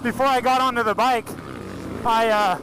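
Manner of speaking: talking inside a helmet; muffled in helmet. Real, not acted. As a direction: speak through a helmet mic, muffled. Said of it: "we actually put a mic in the helmet".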